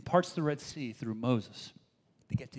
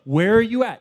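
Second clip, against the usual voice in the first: loud, desperate call to an absent God; panicked cry of the Israelites; 6 semitones higher, 11 dB louder than usual. Retold or imitated. Israelites crying out to God in panic, loud.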